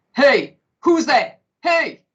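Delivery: loud, slow gruff hey; gruff loud challenge. Acted. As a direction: bark out a challenge from the bushes. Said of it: "a deep, gruff voice said ... very loudly indeed".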